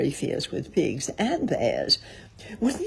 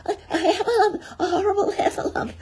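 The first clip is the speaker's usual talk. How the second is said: high, stammering squeaky panic; Piglet crying out; 4 dB louder, faster than usual. A narrator's reading. Piglet fleeing a heffalump, crying out in high panic. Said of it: "cried Piglet".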